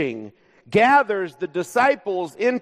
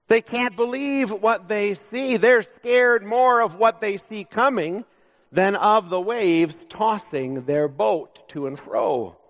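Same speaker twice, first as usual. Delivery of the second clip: normal talking voice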